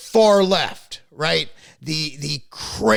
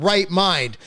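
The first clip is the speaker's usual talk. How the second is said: ordinary talk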